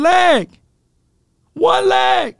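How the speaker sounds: squealed high. Real, not acted. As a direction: pitch it up in outrage. Mocking emphasis.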